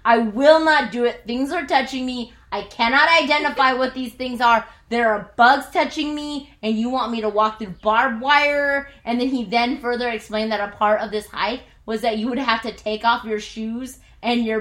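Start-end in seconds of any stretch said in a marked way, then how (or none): 0.0-8.9 louder, indignant protest; stomping off, loud and indignant; retold angry refusal. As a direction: refuse him outright. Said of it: "And I storm".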